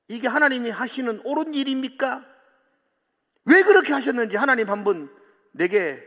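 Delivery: high, loud pleading protest; Job protesting. Retold or imitated. Preacher voicing Job's protest to God, loud high pleading. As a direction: demand an answer from God. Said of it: "항의하고 기도합니다".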